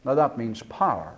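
ordinary talk

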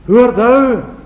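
put-on high, loud call of a blind old father; a blind father's loud alarmed question; startled old Isaac. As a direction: cry out who is there. Isaac calls out.

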